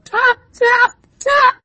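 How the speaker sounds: very high, loud squeaked taps; knocking "tap, tap, tap". A narrator's reading. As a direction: squeak out three sharp knocks. Child knocks at the door.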